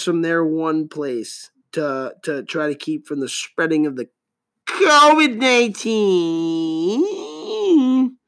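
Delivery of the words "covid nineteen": dramatic announcement. Acted. Dramatic buildup to soundboard.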